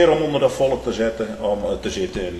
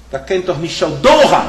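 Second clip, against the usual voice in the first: Abraham's complaint; 6 semitones higher, 8 dB louder than usual. Acted. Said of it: "hij zegt".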